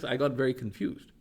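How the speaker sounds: ordinary talk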